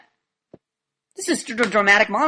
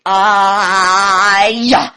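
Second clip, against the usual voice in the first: loud, long-held vowel on one level note; mock drawn-out monotone; elongated word; 7 dB louder than usual. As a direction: stretch the word out and hold it loud. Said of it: "Some of us will elongate".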